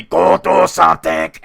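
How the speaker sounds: loud, barked Austrian strongman impression; General Schwarzenegger. General on his shoulder telling him to act, bellowed. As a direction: bellow it as a commanding bodybuilder. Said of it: "I got General Schwarzenegger sitting there telling me".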